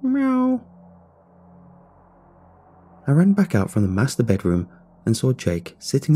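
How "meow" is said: high-pitched imitated meow; faint cat meow. A narrator's reading. Missing cat heard from the shower room, faint meow. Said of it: "I heard a faint sound".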